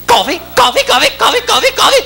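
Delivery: very high repetitive squawk; manic waitress. Acted. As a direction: pester with a high, relentless waitress chant. Waitress pushes coffee again.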